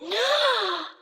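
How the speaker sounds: sharp high thrilled gasp; delighted gasp. Acted. Flirty machine thrilled by a gift, high gasp. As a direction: gasp in mock rapture.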